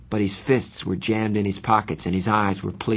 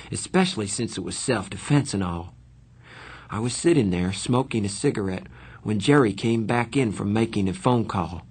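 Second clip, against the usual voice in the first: plain reading voice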